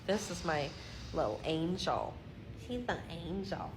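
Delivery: soft, hushed and adoring; tender doting. Real, not acted. Owner showing off her little gal, soft doting murmur. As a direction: coo quietly over a beloved pet.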